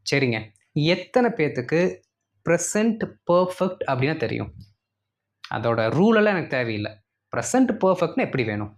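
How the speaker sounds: ordinary delivery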